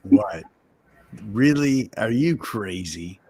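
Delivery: ordinary talk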